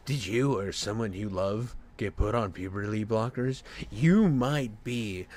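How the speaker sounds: deep, measured parody announcer; lawsuit ad announcer. Acted. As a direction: intone it like a legal commercial. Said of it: "one ad that comes on".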